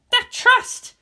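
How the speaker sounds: put-on high mumbling girl. A narrator's reading. Asuka mumbling.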